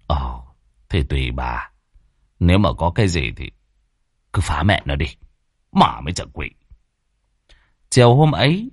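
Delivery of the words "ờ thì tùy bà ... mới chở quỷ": husband brushing off his worried wife, low and curt; gruff husband. Acted. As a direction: lower and softer, curt old husband.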